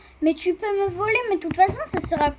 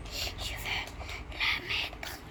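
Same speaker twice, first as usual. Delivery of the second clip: whispering; 13 dB quieter, much slower than usual. Real, not acted. Mutters while placing item.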